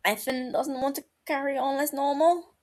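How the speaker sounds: ordinary delivery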